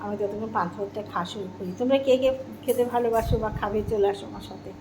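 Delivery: normal talking voice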